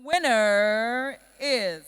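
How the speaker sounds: slow, loud drawn-out build-up; suspenseful awards presenter. Acted. Suspense before naming winner.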